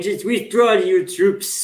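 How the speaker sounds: slow, high mock German accent. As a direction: drag it out in a put-on German accent. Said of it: "German accent saying".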